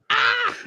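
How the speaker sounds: a very high cry of amazement; a sudden screaming Ah of realisation; shocked shriek. Retold or imitated. Storyteller shrieking as the premonition comes true.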